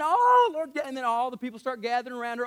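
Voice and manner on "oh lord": a hammed-up crying "Oh, Lord", higher and louder; mock wail. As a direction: wail it like a weeping mourner. Mary crying, crowd gathering.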